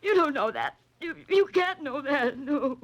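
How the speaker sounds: backing away from the truth, voice climbing; frightened denial. Acted. Ruth denies Holliday knows her secret, frightened and pleading.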